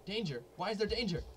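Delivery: startled by the danger warning; alarmed. Real, not acted. Gamer startled by a danger alert, alarmed.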